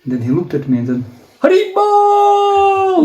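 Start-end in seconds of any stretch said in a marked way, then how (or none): 1.4-3.0 long, high, loud drawn-out shout; loud call. Retold or imitated. Guru hailing the army man with a loud high shout. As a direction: bellow it out like the excited guru. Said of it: "very loudly".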